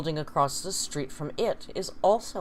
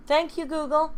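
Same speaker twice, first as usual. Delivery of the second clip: high, louder thanks; calling out thanks; 12 semitones higher, 6 dB louder than usual. Real, not acted. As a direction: pitch it way up, addressing the smart speaker. Calling thanks to the smart speaker.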